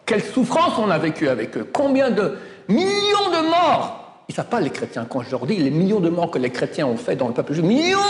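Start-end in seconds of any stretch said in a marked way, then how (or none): 1.7-4.2 high, slow, stretched lament; anguished exclamation. Real, not acted. Rabbi decrying persecution. 7.6-8.0 sharp high outcry; indignant cry. Real, not acted. Rabbi insisting on the death toll, sharp high cry.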